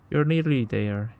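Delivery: normal talking voice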